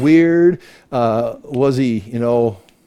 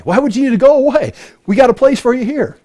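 high, fast, begging townsfolk voice; pleading townspeople; 9 semitones higher, faster than usual. Retold or imitated. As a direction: plead with him to stay, much higher. Townspeople voiced begging him stay.

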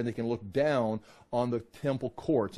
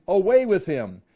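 raised voice, a narrated crowd's cry; crowd's shout; 6 semitones higher, 7 dB louder than usual. A narrator's reading. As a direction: read it as a mob calling out, louder and higher. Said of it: "kept shouting".

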